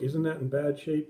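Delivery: usual speaking voice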